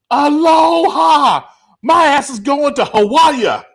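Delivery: put-on high, loud, drawn-out drunken yell; drunken shout. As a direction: bellow it like the drunkest guy there.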